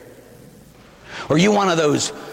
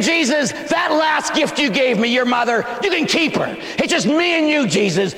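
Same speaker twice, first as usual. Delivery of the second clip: preacher mocking a believer who rejects Mary; high shouted mock rejection; 8 semitones higher than usual. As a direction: throw the gift back with scorn.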